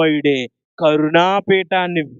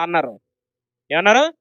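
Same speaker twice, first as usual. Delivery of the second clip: ordinary talk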